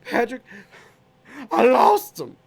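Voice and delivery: high, loud, distressed wail; panicked bartender. Retold or imitated. Bartender confessing to Patrick, high and panicked. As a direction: blurt it out in panic. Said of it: "He goes, he goes".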